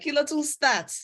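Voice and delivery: put-on high surprised question in Yoruba accent. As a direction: fire it off in a Yoruba voice. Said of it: "In my Yoruba voice".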